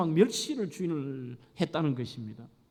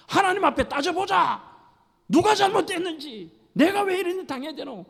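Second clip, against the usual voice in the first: much higher, louder raging challenge; furious wife; 10 semitones higher, 4 dB louder than usual. Acted. Wife shouting at her husband in fury. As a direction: demand judgment before God. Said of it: "더 심각한 말을 합니다".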